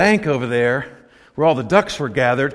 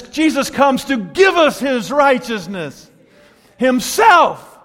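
preaching shout; 8 semitones higher, much slower than usual. Acted. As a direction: cry it out to the congregation.

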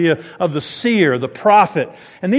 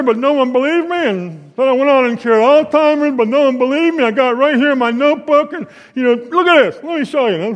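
higher-pitched, insistent crazy-man rant; ranting braggart; 6 semitones higher than usual. Acted. Preacher playing a crazy man with grand claims, ranting. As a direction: pour out wild boasts in a high, pressing voice.

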